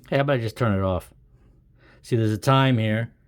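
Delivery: ordinary talk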